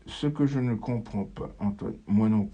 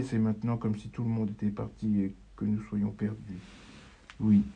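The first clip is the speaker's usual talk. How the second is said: normal talking voice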